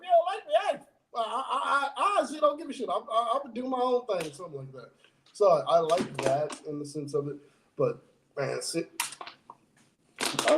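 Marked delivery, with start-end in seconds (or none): 0.0-4.3 high, dismissive mock voice; C M Punk. Fan voicing a wrestler who just does his own thing, mock dismissal. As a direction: shrug it off in a pitched-up impression, half laughing. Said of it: "C M Punk's like".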